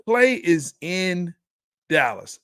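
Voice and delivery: "Klay is in" drawn out, then "Dallas" set apart; measured restatement with a pause before "Dallas"; slow, deliberate emphasis. Real, not acted. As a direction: spell it out slowly, pausing before "Dallas". Repeats statement slowly for emphasis.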